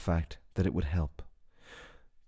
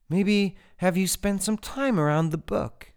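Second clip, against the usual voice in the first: high, brighter retelling; 12 semitones higher, 4 dB louder than usual. A narrator's reading. Jane passes the librarian's advice to Tyler, high.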